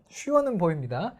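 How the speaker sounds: normal talking voice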